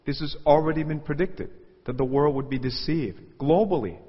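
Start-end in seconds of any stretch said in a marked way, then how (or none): none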